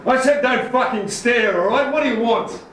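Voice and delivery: loud and indignant; angry challenge. Acted. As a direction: snap it at someone who is staring. Confronts someone staring at them.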